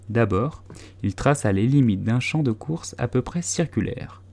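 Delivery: ordinary delivery, nothing marked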